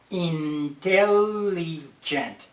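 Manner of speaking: Intelligent stretched very slowly, each syllable separate; syllables counted out. Shown as a demonstration. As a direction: spell out Intelligent by its four beats. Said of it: "How many syllables?".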